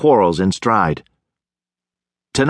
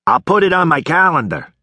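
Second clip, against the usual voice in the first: bark; 7 semitones higher than usual. Acted. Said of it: "he barked".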